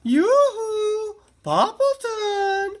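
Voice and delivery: a bright high neighbourly call across the yard; calling out as Cherry Sue. A narrator's reading. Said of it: "she called out".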